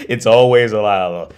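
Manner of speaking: put-on loud, fed-up old shopkeeper; weary old man. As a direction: boom it out like he's heard it a million times. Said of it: "And he goes".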